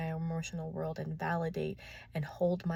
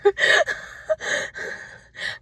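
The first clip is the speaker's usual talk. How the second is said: loud high laugh; 9 dB louder than usual. Real, not acted.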